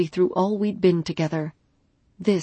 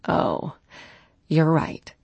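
sighing; slower than usual. A narrator's reading. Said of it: "she sighed".

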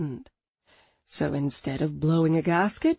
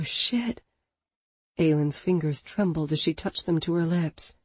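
usual speaking voice

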